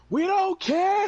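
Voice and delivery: sing-song it down from the balcony; high, slow mocking crowd chant; balcony heckler. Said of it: "somebody in the balcony talk about some".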